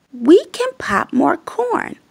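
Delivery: breathy whispered mouse; whispering mouse. Acted. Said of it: "Mouse squeaks".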